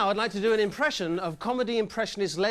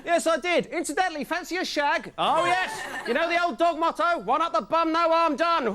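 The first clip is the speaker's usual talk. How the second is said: high, lively dog voice; a randy dog chatting up another; talking dog; 9 semitones higher than usual. Acted. Dog answering a neighbour dog, high and cheeky. Dogs chatting after whistle.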